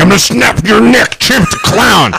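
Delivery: high, hammed-up menacing threat; mock tough-guy threat.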